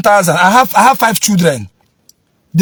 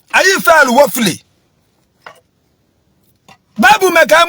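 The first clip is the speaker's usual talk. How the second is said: fiery preaching; 6 semitones higher than usual. Acted. Preaching to viewers that parents failed their children, shouting. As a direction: preach it hard to the congregation.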